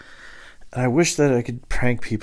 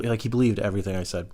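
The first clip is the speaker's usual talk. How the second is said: ordinary talk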